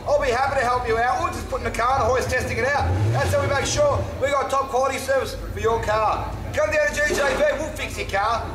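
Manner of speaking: friendly manager pitching his garage; cheesy car ad. Acted. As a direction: sell it like a cheesy local TV ad.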